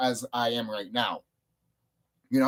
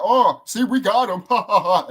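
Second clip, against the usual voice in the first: higher, louder taunt with a laugh; mock gloating; 6 semitones higher, 7 dB louder than usual. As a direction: imitate smug foes cackling over a catch. Mimics his gloating opponents.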